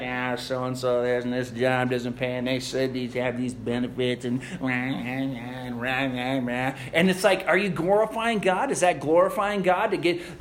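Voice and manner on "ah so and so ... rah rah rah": low, muttered mock complaining; mock the griping crowd at the coffee machine; grumbling coworker. Preacher mocks coworkers complaining at the coffee machine, low and muttered. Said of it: "everybody's like".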